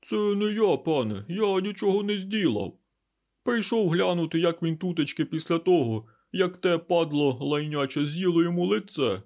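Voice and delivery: high, loud creature voice pleading innocence; frightened babbling. A narrator's reading. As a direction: babble scared excuses, pitched high. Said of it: "злякано забелькотівши".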